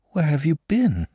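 frightened whisper. Acted. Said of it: "she asked in a frightened whisper".

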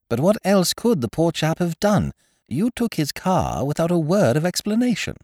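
high-pitched, earnest self-justification; high, reasonable explaining; defensive protest. A narrator's reading. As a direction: plead your case in a high voice. Looney defends himself to Ukridge.

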